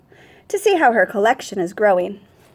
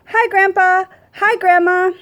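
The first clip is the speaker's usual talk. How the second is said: put-on higher, bright child's greeting; little girl greeting; 8 semitones higher than usual. A narrator's reading. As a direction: greet grandpa and grandma like an eager little girl. Child greeting grandparents in story.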